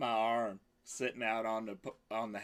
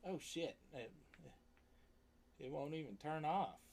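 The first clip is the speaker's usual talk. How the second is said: normal talking voice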